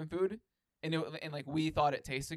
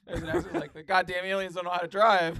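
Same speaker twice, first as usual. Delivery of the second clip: laughing loudly through the line; loud laughing delivery; 5 semitones higher, 9 dB louder than usual. Real, not acted. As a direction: crack up while you say it. Laughing while joking about aliens.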